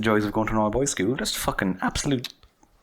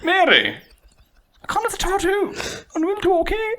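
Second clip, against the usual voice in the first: much higher put-on voice; acting as a worried student. Student asks Mary if she's okay, high put-on voice. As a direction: ask after her in clumsy exam Irish. Acted Irish exam dialogue.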